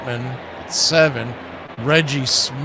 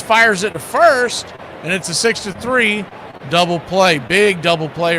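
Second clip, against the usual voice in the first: rising, louder play call building to the double play; excited play-by-play; 5 semitones higher, 4 dB louder than usual. Acted.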